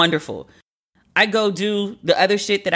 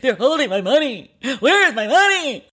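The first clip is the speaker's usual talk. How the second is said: much higher panicked replay of her call; retold meltdown; 7 semitones higher than usual. Calling her friend early morning, a higher retold panicky meltdown. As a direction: recreate the ugly freak-out on the phone. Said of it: "God, it was ugly".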